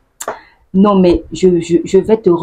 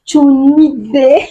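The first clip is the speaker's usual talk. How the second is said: high exclamation then a slow, louder complaint; exasperated Ivorian; 7 semitones higher, 4 dB louder, slower than usual. Acted. To an annoying person, mock exasperation. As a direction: groan it at someone annoying. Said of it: "Quand un Ivoirien te dit".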